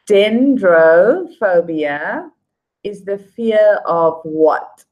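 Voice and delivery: slow, emphatic question, syllables drawn out. Real, not acted. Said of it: "say it louder".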